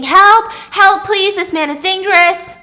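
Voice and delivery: high, loud cry for help; screaming for help. A narrator's reading. Wife calls out to a hotel lobby, screaming. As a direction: scream desperately for rescue. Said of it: "She starts screaming".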